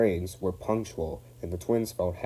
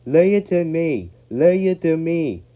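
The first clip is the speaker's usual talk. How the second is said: high, loud shout; Douglas shouting; 9 semitones higher, 10 dB louder than usual. Acted. Douglas urging James on, shouting. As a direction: bellow encouragement. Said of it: "shouted Douglas".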